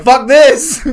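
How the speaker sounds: high, loud comic bail-out; mock alarmed shout. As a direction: yell it like you're bolting. Acting out fleeing in alarm.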